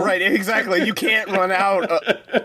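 high laughing disbelief. Real, not acted. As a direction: laugh it out, incredulous. Laughing at the absurd sell-out.

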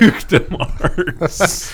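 slow words through laughter; cracking up mid-phrase; laughing. Real, not acted. Repeating joke while laughing.

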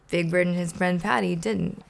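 plain reading voice

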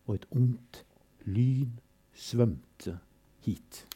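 measured, grave recital with refrains; solemn poem reading. Acted. Reading Todesfuge aloud to listeners, grave and measured. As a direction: let the fugue's repetitions toll.

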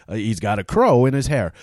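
ordinary talk

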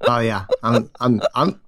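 ordinary talk

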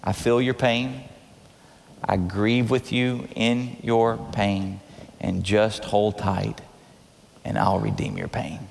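low, slow, consoling voice; deep, unhurried reassurance; tender comfort. Acted. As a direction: speak gently to someone grieving, very low. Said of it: "here's how he does respond to Mary".

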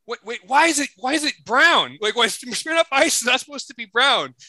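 high, baffled sister voice; confused sister. Retold or imitated. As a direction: up high and puzzled, questioning the brown drink.

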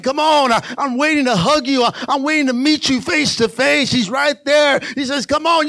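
loud, high encouraging call; cheering preacher. Acted. Jesus cheering runners at the finish line, loud. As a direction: cheer them over the line.

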